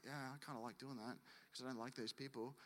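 very soft, mumbled confession; sheepish admission. Acted. Believer admitting gossip to God, sheepish and hushed. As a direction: own up quietly, as if caught.

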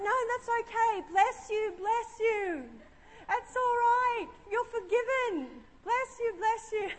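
high, sing-out priestly absolution; mock priest blessing. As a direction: bless them like a put-on priest. Plays priest absolving the husband.

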